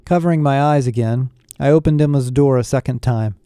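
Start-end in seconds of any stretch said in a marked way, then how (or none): none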